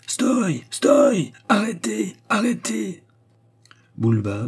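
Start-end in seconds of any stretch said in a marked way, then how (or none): 0.1-3.0 much higher, desperate yell; frantic high-pitched cries to stop; shouting. A narrator's reading. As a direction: scream after the leaving train. Boulba shouting at departing train.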